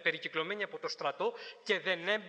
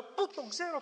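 "Πού" jumps up high in a baffled rising question; puzzled question; 9 semitones higher than usual. Retold or imitated. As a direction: ask yourself, truly stumped. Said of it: "προσπαθούσα να". (σαστισμένη ερώτηση με το «Πού» ψηλά)

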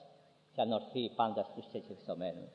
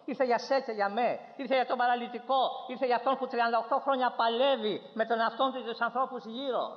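high, driving sermon voice; impassioned preaching; 10 semitones higher, 4 dB louder than usual. Real, not acted. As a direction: build the list with high, insistent fervour. Preaches at full force.

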